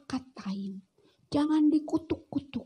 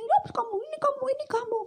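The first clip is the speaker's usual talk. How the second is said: much higher scolding tut; high reproachful clucking; tut-tutting; 12 semitones higher, much faster than usual. Acted. Judging a sick friend.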